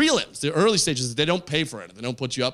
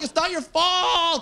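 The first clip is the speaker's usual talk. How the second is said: high, slow, hammed-up cry; mock yelling; 13 semitones higher, slower than usual. Comic yelling at the moon, melodramatic cry. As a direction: stretch it out and yell at the sky. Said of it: "I just yelled at the moon".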